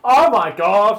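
mock shock. Reacting to Scarlett Johansson casting.

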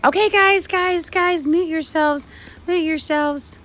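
high, urgent call for order; high-pitched teacher calling out. Acted. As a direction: rein in a noisy class.

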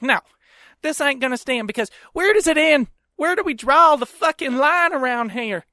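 mock indignant Southerner. Wife refuses husband and rants, mock Southern indignation.

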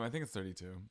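ordinary talk

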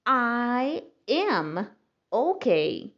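"I", "am", "ok" said as separate words, then joined slowly. Shown as a demonstration. Teacher builds "I am ok" word by word. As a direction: say each word apart, then join them. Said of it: "eu estou ok".

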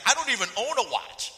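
loud, high retort; exasperated protest. Retold or imitated. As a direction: protest in mock outrage. Retold outraged reply to friend.

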